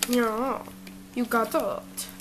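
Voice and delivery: low, hushed put-on voice; that "yeah" voice. Puppet answering friend about the game controller, odd hushed voice. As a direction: drop into a soft, strange character voice. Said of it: "Last time you were in that voice".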